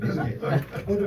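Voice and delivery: usual speaking voice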